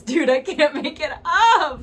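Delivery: loud, high, drawn-out laughing disbelief; cracking up. Real, not acted. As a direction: let it burst out laughing, slow and high. Cracks up at the card.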